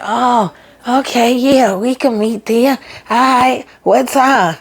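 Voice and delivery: high, eager yeah-we-can-meet; giddy and eager. Retold or imitated. Gary eagerly agreeing to meet his ex, high and giddy. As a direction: gush like a smitten man.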